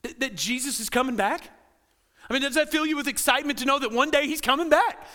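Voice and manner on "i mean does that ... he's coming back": rising, urgent pulpit appeal; fervent preaching. Acted. Pastor urging the congregation, fervent preaching. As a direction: press the hope home to the congregation.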